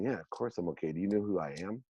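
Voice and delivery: dismissive, softly muttered self-importance; arrogant eye-roll. Retold or imitated. Arrogant man brushing off a concerned seatmate, arrogant and dismissive. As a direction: toss it off quietly with mock arrogance. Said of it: "my arrogant asshole self rolled my eyes back going".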